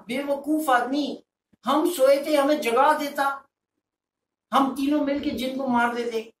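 angry friends telling him off; scolding. Acted. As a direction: scold him, you fool.

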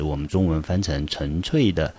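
ordinary talk

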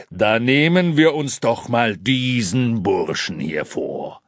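loud, ringing Santa announcement; booming voice. A narrator's reading. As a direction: boom it out to the crowd. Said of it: "mit seiner schallenden Stimme".

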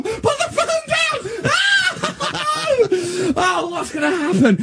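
high wail breaking into laughter; despairing panic. Real, not acted. [name] begs for the call to end, panicked wailing. Despairing after disastrous second message.

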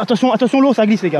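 shouted warning; loud warning. Real, not acted. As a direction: yell a warning. Warning friends, slippery.

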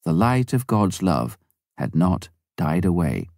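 usual speaking voice

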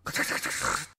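whispered rattling doorknob noise. Retold or imitated. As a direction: rattle it out under your breath. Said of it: "ドアノブを回してるのです".

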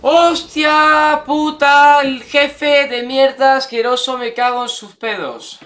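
slow, loud, high drawn-out swearing; furious cursing. Real, not acted. Player cursing the disgusting boss, furious. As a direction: curse the boss out, dragging each word.

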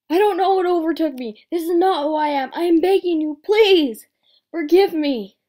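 loud, high, pleading cry; desperate begging. Acted. A character begging a lover to forgive him, loud and high. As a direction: beg for forgiveness at full voice. Acted plea in an audition.

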